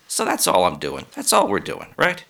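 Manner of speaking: ordinary talk, nothing marked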